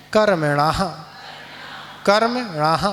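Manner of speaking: कर्मणः ending in a breathy echoed ह; visarga on कर्मणः. Shown as a demonstration. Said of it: "णा के बाद क्या है? विसर्ग". (कर्मणः में विसर्ग को हल्की "ह" गूँज से बोलें)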